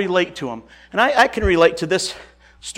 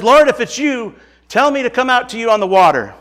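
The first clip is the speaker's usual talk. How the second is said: higher and louder, calling across the water; 7 semitones higher, 6 dB louder than usual. Acted. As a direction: shout it out over the storm. Peter calls out to Jesus.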